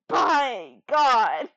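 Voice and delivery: ordinary talk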